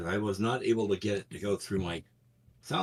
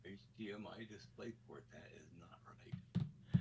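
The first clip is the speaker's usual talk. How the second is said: distant, faint, muttered far off; speaking from another room; in the other room; 2 semitones lower, 23 dB quieter than usual. Real, not acted. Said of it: "you sound like you're in the other room".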